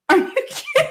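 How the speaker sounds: high, real laughter breaking the words; laughing disbelief at the lawyer. Real, not acted.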